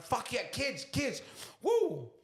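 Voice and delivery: put-on high-pitched whoop to the kids; pumped-up dad cheer; excited rallying. Acts out rallying his kids.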